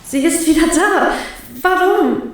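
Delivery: put-on a girl terrified of her returning power; rising panic. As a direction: despair at it coming back.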